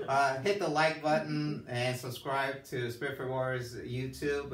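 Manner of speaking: loud sign-off to viewers; calling out. Real, not acted. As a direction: call out to the audience.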